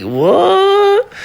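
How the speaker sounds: high squeaky mock surprise. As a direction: squeal it like a shocked person. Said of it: "people like".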